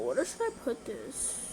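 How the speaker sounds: usual speaking voice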